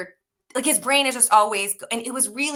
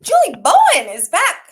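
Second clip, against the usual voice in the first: high, loud mock exclamation; hammed-up cry about a star; retold excited outburst; 8 semitones higher, 11 dB louder than usual. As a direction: belt it out, pitched way up.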